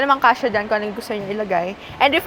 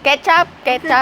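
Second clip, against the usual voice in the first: playful calling out; 3 semitones higher, 4 dB louder than usual. Pretend. Joking call of ketchup.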